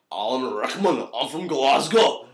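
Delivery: slow, hammed-up Scottish accent; Scottish-sounding Rickman impression. Sending up their Alan Rickman impersonation, broad Scottish accent. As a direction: lay on a drawn-out Glasgow accent. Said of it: "it also sounds quite Scottish".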